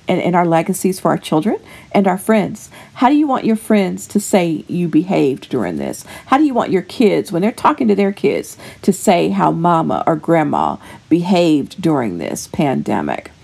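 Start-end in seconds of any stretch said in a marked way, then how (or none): none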